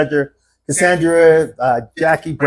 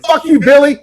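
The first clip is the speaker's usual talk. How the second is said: louder, higher, fed-up call-out; 10 semitones higher, 5 dB louder than usual. Retold or imitated. Shouting after Billy.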